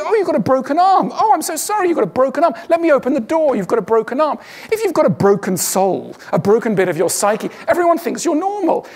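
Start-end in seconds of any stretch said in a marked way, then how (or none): 0.0-4.4 much higher, gushing sympathetic voice; mock sympathy. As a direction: fuss over someone with a broken arm. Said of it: "Everyone can see".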